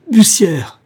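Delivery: loud, high-pitched cry of recognition; full-voiced, horrified yell; howled name. A narrator's reading. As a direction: scream the culprit's name. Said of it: "hurla Sternet".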